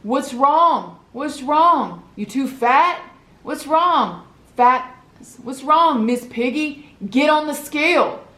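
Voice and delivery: mock the little girl, needling her; mocking taunt. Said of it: "So he's like".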